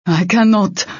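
protesting. A narrator's reading. Icy refusing to fight mid-battle, restrained protest. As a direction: refuse under pressure, short of a shout.

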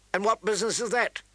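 cold challenge, lightly acted; icy. A narrator's reading. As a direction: ask it with ice in the voice. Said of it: "Ned put ice in his voice".

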